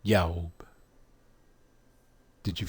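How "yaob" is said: Yaob said as "yow-b", low from the gut; guttural pronunciation. Shown as a demonstration. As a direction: say Yaob with a drawn "ow" glide. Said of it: "Try this".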